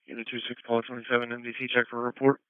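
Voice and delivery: ordinary delivery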